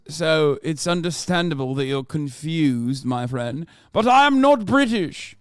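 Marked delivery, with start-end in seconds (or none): 3.9-5.4 loud exasperated rant. Real, not acted. Streamer ranting at a viewer about not being British, loud and exasperated. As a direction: hammer home that he's not British.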